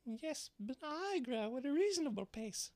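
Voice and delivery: very high, squeaky little voice; prim little mouse retort; dormouse voice. A narrator's reading. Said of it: "said the Dormouse".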